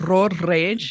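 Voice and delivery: "road rage" with a rolled R at the start of "road"; rolled R demo. Shown as a demonstration. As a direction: roll the R in "road" hard. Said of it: "roll your R's?".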